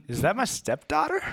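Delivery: put-on high-pitched startled question in own accent; dramatic disbelief. Stepdad spots the kidnapping, high dramatic disbelief. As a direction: redo it in American, rising in alarm. Redoes the line without British accent.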